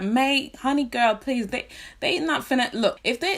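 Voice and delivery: high, sassy disbelief at a friend; mock-exasperated honey-please; sassy retort. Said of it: "And I was like".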